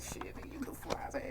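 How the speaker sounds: hushed, breathy mirror pep talk; voiceless whisper to the mirror. Retold or imitated. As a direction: whisper it to yourself like a secret compliment. Self-talk at the mirror, retold.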